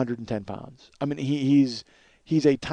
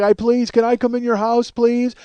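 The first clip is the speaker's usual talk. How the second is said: high, whiny mock begging; imitated yelled pleading; 8 semitones higher, 4 dB louder than usual. Neighbor begging to come inside, mock yelled plea. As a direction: act out a neighbor pleading through the door. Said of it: "he's yelling".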